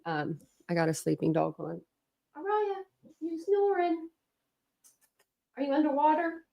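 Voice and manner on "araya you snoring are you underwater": soft, high pet-talk voice; cooing to dog. Real, not acted. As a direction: coo quietly at a sleeping dog. Talking to her sleeping dog.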